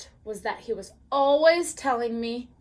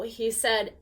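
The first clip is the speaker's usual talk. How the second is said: usual speaking voice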